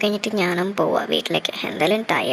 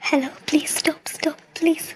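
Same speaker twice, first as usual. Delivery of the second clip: breathless whispered plea; 5 semitones higher than usual. Acted. Begging passing vehicle to stop.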